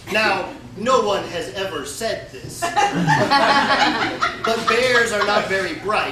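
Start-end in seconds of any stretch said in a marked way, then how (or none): 2.8-4.6 laughing. Real, not acted. Storyteller laughs mid-story.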